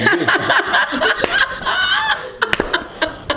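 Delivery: long high-pitched laughter; big laugh. Real, not acted. Reacting to the guest's risqué remark, long high laugh. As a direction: burst out laughing at length.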